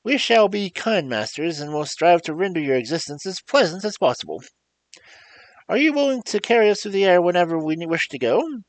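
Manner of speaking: kind Scarecrow. Acted. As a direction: cheer up a gloomy creature. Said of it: "said the Scarecrow kindly".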